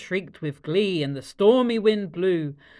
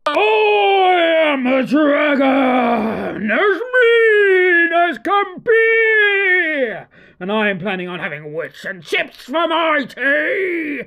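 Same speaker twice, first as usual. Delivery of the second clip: very slow, loud roaring dragon; scary loud roar; 6 semitones higher, 6 dB louder, slower than usual. Acted. Dragon threatening the witch, loud slow roar. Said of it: "a roar that was scary and loud".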